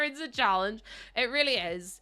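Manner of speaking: ordinary delivery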